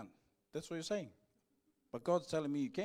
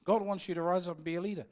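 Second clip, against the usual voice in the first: louder, fast preaching call; preaching at full force; 4 semitones higher, 8 dB louder, faster than usual. Acted. Pastor rallying the church, louder and fast. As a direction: drive it home to the crowd.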